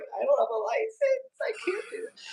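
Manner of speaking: very high, wailing half-laughed sob; mock crying. Teen wailing to the neighbor after the crash, mock sobbing. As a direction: whimper it out like a panicked kid.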